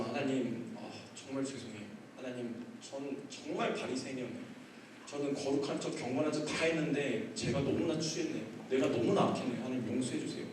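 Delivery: hushed, remorseful reenacted prayer; tearful repentance. Pastor repents before God, hushed remorse. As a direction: softly plead for forgiveness. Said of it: "눈물을 흘리면서 회개를 하고".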